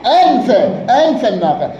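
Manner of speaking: "أنف" ending in a clear -a, anfa; accusative ending -a. Shown as a demonstration. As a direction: sound the fatha at the end of "أنف". Case endings shown in grammar example.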